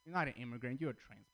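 ordinary talk

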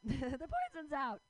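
cracking up at her own line; laughing repeat. Real, not acted. Repeating her punchline to the crowd, laughing.

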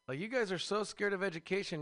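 normal talking voice

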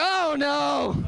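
sudden horrified groan; dismayed realization. Retold or imitated. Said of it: "I was like".

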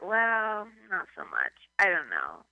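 imitated gloomy crowd; deflated crowd reply. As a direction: answer back flat and disappointed. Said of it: "people would be like".

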